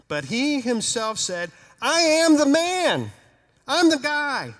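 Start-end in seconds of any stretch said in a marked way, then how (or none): 1.8-4.5 loud, high, joyful insistence; loud and overjoyed, proving it's really him; 1 excited claim. Acted. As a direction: shout out who you are, thrilled. Healed man insisting loudly.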